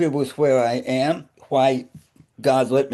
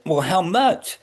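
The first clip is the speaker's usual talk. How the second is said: higher-pitched questioning; retold wary question; 6 semitones higher than usual. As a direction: ask it high, as in a retold exchange. Retelling his anxious question to doctors.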